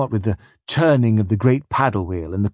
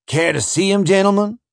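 eager overseer; 9 semitones higher than usual. Acted. Overseer offers, rising eagerly.

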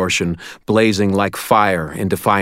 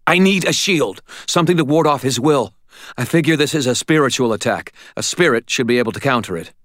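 urgent; 6 semitones higher, faster than usual. A narrator's reading. Urgent plea to spirit.

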